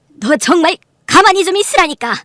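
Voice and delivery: high, loud reprimand; exasperated scolding. Acted. Mother telling her daughter to keep still, exasperated. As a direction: shut her up loudly.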